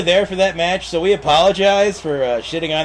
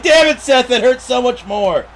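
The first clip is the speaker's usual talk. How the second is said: higher, louder mock wail at Seth; mock anguish; 7 semitones higher, 4 dB louder than usual. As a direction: cry out like a betrayed child, hammed up. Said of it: "I'm like".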